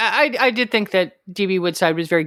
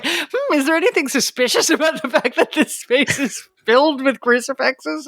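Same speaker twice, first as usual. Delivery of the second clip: mock suspicion; 5 semitones higher than usual. Mocking Robin's crucifix room.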